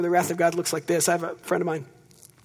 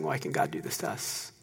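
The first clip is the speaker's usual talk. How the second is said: breathy whisper, much lower and softer; whispered complaint; 8 dB quieter than usual. Retold or imitated. Preacher voicing Israel whispering its lament to God.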